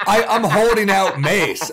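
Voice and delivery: laughing, worked-up and loud; pumped and ready to fight, half laughing; fired up. Retold or imitated. Retelling the hotel break-in, worked up and laughing. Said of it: "I'm angry".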